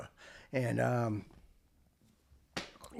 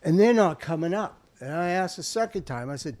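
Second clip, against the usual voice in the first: plain reading voice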